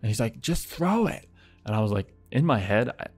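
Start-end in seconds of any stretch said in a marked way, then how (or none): 0.4-1.3 higher-pitched, insistent retold demand; mock-eager push to get it thrown; insistent urging. Guest urging his host to toss him a fork, insistent.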